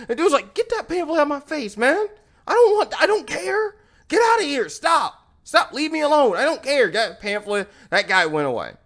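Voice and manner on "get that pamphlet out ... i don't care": high-pitched agitated brush-off; mock fed-up protest, quick and high; irritated rebuff. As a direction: act out shooing someone away, voice pitched up. Acted dude rebuffing pamphlet man.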